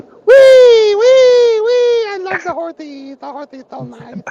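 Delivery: put-on very high squealing "wee", then high babyish delight; squealing midget. Midget riding the horse in the joke, high squealing glee. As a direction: squeal like a kid on a pony, then coo. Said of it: "And the midget's going".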